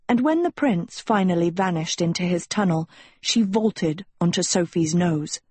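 ordinary delivery, nothing marked

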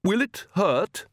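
high, slow anxious question; fretful, drawn-out question; nervous. A narrator's reading. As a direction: ask timidly in a high voice. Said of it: "said the Countess nervously".

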